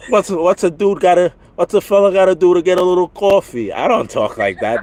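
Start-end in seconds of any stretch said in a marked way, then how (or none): 0.1-3.7 loud, higher hammed-up Brooklyn Italian; Brooklyn Italian accent. Streamer shows what he doesn't sound like, caricature Brooklyn Italian accent. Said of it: "That's like the Italians in Brooklyn".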